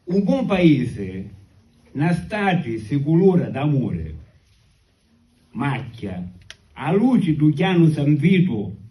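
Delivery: slow declaimed poem in Sicilian; sicilian accent. Acted. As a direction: recite the verses slowly in Sicilian. Recites his second Sicilian poem.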